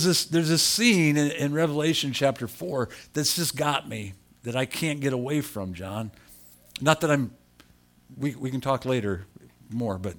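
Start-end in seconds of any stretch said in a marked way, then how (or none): none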